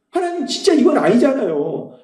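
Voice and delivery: high-pitched retold complaint to God; acted-out frustrated protest; frustrated prayer. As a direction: plead in mild exasperation. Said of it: "근데 너무 답답한 거예요".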